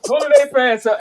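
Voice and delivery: half-shouting how scary it looked, pitch up high; telling his friend he'd be out of there, excited and loud; excited, alarmed. Real, not acted. Terrified by crew running out.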